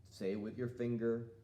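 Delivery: ordinary talk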